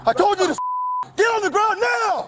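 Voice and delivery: very high, urgent yelled orders; shouted surrender demands. Real, not acted. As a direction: scream the order at him. Officer yells commands in scuffle.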